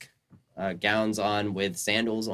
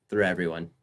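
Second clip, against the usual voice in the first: ordinary talk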